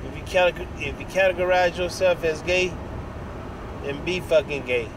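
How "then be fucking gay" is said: normal talking voice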